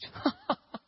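high burst of disbelieving laughter; re-enacted laugh. Young pastor offered the whole church, re-enacted incredulous laugh. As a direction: imitate laughing at an absurd offer. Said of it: "I laughed about like that".

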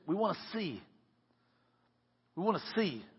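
soft, simple acted request; hushed, earnest wish; quiet plea. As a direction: say it softly and let it sit. Same plea repeated for emphasis.

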